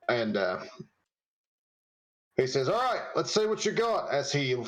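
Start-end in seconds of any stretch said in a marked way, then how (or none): 2.7-4.1 put-on Australian accent. Australian NPC speaks.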